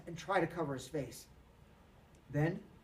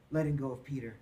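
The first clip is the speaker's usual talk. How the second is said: plain reading voice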